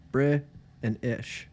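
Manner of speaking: "Bri" punched, then "ish" after a pause; split syllables. Shown as a demonstration. Teaching the British accent, "Bri" and "ish" said separately. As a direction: break the word into "Bri" and "ish". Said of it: "There's two I's".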